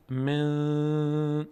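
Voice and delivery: "من" with the ن held long through the nose; nasal ikhfa. Shown as a demonstration. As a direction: slowly stretch "من" into a nasal hum.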